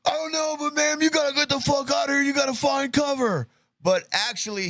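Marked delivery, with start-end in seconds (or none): 0.0-3.5 high, strained mock yell; imitated urgent shouting. Host as man telling ma'am to find cover, high mock shout. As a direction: shout it high, hurrying someone to safety. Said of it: "And he's like".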